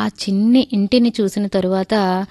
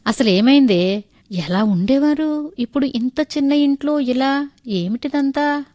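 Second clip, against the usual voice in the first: higher-pitched, concerned questioning; unable to hold back; 6 semitones higher than usual. A narrator's reading. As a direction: ask as if you can't contain the question any longer. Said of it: "ఉండబట్టలేక అడిగేశాను". (ఉండబట్టలేక ఆరాటంగా అడగడం)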